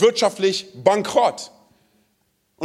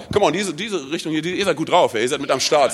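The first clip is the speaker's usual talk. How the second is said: usual speaking voice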